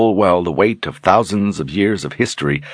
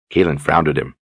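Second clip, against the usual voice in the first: ordinary delivery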